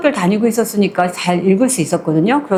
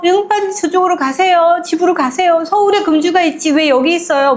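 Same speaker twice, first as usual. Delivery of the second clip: put-on high, urgent old woman hurrying him off; an old nanny pushing the young master home; shooing old grandmother; 8 semitones higher than usual. Grandmother urging young master to leave, high and pressing.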